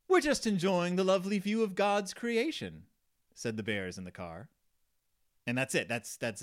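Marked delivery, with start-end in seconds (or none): 0.1-2.8 high-pitched put-on bear voice; cartoon bear voice. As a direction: read as a storybook bear, pitched up. Bears in car, read aloud.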